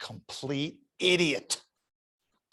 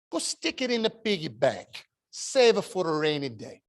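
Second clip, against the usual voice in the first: lightly voiced Italian grandmother accent giving advice; Italian grandma accent; 3 semitones higher than usual. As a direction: hand over the money in a mild Italian accent. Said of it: "This heavy Italian accent".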